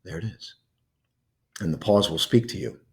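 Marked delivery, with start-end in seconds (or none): 0.0-0.5 soft noticing. Shown as a demonstration. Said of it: "you want to say".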